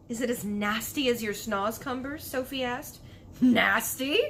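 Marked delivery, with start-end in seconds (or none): 3.4-4.2 loud indignant giant yelp; outraged cry at the insult. Acted. BFG offended by Sophie's question, loud indignant cry. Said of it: "cried the B F G".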